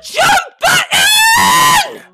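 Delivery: very high, loud, slow shriek; full-blown rage shriek; furious scream. Real, not acted. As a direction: scream every word at the game. Rage at failed jump input.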